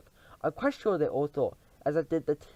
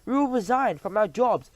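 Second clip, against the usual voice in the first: eager chorus; 7 semitones higher, 7 dB louder than usual. Acted. Witches answer eagerly.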